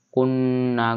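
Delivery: কুন্না with the double ন hummed through the nose; nasalized ghunnah. Shown as a demonstration. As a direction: hold the ন্না nasally. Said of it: "গুণনা করে পড়তে হবে". (কুন্না-র ন্না গুন্নাহ করে)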